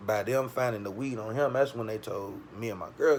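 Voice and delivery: ordinary talk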